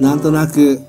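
ordinary talk

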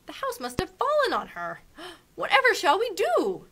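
high, loud, gasping in dismay; Dorothy frightened, asking the witch what to do. A narrator's reading. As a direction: panic in a little girl's voice. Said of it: "in dismay".

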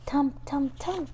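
soft whispered thump thump thump; child whispering a heartbeat sound. Acted. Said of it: "And the heartbeat says".

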